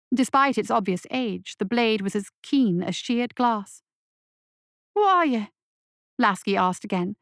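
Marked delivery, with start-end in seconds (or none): 4.9-5.5 put-on higher, urgent young voice; scared boy. A narrator's reading. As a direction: ask it like a frightened kid. Boy asks monster.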